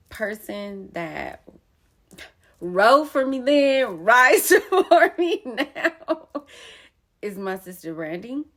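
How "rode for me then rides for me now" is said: loud, laughing through the words. Real, not acted. Proudly praising the sister who rode for her, laughing loud.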